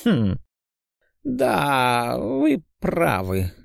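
put-on high, drawn-out old man's musing; elderly doctor pondering. A narrator's reading. Old doctor considering the patient's answer, slow musing. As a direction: mull it over slowly as an old physician.

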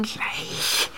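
tonlos gehaucht; geflüstert. Acted. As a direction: haucht kurz zurück. Verführer antwortet aus dem Dunkel. (gehauchtes Flüstern)

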